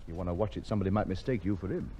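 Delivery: usual speaking voice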